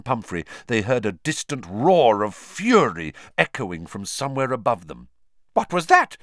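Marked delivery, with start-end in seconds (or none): 5.5-6.2 high, loud start of alarm. A narrator's reading. Matron hearing a distant roar, alarmed. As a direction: react to a sudden noise with raised, louder voice. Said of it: "said Madame Pumphrey in alarm".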